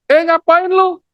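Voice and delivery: high-pitched casual call to someone; passer-by's question. Acted. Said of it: "Terus nanya". (orang lewat bertanya dengan nada tinggi)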